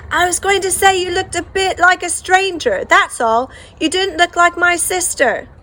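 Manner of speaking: loud, high, slow shouting over the crowd; shout over noise. Acted. Brother shouting to sister over the noisy hall, loud. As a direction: yell it so she hears over the hall. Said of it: "I had to shout".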